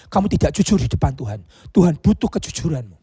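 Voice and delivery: normal talking voice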